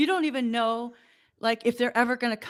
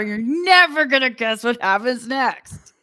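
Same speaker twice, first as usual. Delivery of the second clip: louder, hammed-up teaser read; clickbait headline voice; 7 dB louder than usual. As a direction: sell it like a cheesy clickbait hook. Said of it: "Twenty-three things".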